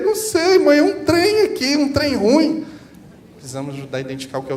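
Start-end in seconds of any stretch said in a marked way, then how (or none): 0.0-2.7 put-on very high, loud, slow plaintive child voice; a little kid who can't explain the hurt; whining child. Child answering the mother from Minas, plaintive and whiny. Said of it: "a criança chega pra mãe mineira e fala assim".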